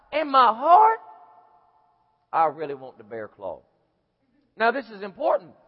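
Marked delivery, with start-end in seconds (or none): 0.1-3.6 inner confession. Acted. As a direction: let the secret craving sink low. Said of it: "my heart's saying".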